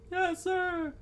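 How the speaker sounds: very high falsetto yell; squeaky, eager salute of a voice; obedient kids. Acted. Boys answering their young leader, eager.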